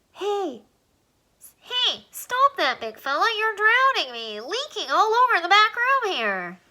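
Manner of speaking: high, squeaky little alien telling him to stop; a tiny voice fussing at a flood; squeaky alien protesting. Acted. Alien tells crying Alan to stop, high and squeaky.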